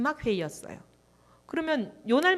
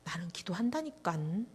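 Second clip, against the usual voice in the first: quiet stubborn pastor; 3 semitones lower, 7 dB quieter than usual. Retold or imitated. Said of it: "목사님 가만히 눈을 감고".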